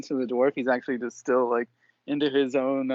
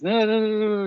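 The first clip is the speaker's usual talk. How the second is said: dwarf protesting; 6 semitones higher, 6 dB louder, faster than usual. Acted. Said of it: "So he says".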